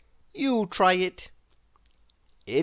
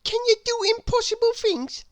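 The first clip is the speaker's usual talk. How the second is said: put-on much higher little boy voice; bright childlike schoolboy voice; young William. A narrator's reading. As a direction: pitch it up like an eager small boy. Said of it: "asked William".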